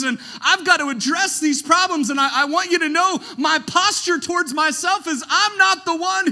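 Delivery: loud, high, preaching at full force; impassioned Jude. Acted. Jude pleading with the church, loud and fervent. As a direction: pour out God's love with fervour. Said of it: "Jude said".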